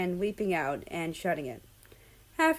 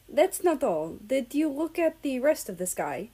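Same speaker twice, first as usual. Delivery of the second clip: awestruck; 6 semitones higher than usual. A narrator's reading. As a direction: voice lifted in wonder, noticeably higher. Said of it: "Russia sounded awestruck".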